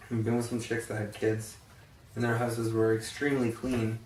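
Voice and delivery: usual speaking voice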